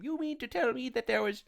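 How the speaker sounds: high-pitched mock disbelief; sarcastic. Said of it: "I have to stop with the sarcasm".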